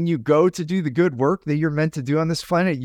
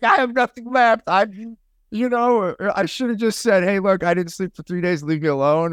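mock frazzled self; 4 semitones higher than usual. Acting out his sleep-deprived self.

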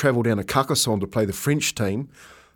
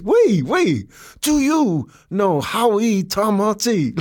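put-on high, slow, stretched-out French-accented English; 9 semitones higher, slower than usual. French stranger repeats his question to tourist, high slow French accent. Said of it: "in his French accent".

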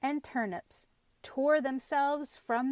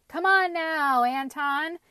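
loud, higher coaxing tone; persuasive; 6 semitones higher, 9 dB louder than usual. A narrator's reading. Pumpkin bargaining with the boy, persuasive. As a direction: coax him with raised voice. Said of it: "in a persuasive voice".

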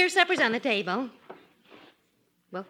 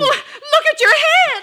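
much higher, much louder outburst; shocked shout; 12 dB louder than usual. Acted. Wife shouting at her husband's haircut. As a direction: cry it out in disbelief.

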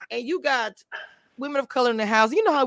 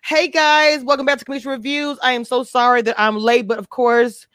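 loud, raised-pitch host welcome; hyped show greeting; 3 semitones higher, 7 dB louder than usual. Real, not acted. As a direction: greet the audience with big energy. Host opens her show.

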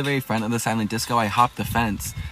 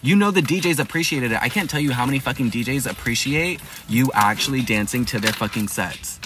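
normal talking voice

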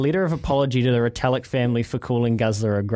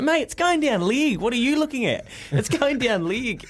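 imitating Louis; 8 semitones higher than usual.

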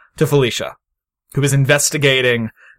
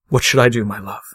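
troubled doctor character; 4 semitones lower, faster than usual. Acted. Doctor addresses dead wife's picture.

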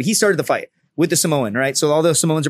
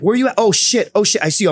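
higher, excited phone exclamations; imitated urgent buddy; 6 semitones higher than usual. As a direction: replay a friend spotting you mid-call. Buddy's urgent phone call retold.

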